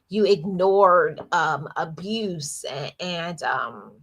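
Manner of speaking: ordinary talk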